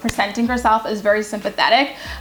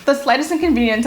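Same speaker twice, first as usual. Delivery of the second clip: ordinary talk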